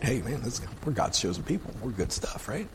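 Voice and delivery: soft, throwaway self-satisfaction; mock smug boast, kept quiet; puffed-up smugness. God's chosen people congratulating themselves, quiet mock smugness. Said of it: "a little puffed up chest thing".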